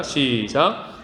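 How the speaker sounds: ordinary delivery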